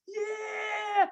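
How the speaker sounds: very high, slow, sing-song shout; gloating daughter. Retold or imitated. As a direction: flex on him like a bratty kid. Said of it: "she's flexing on me like".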